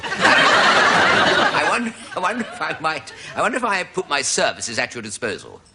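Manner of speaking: a lightly voiced posh horse offering his services. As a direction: ham up a posh gent of a horse. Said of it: "a bit posh, actually, this horse".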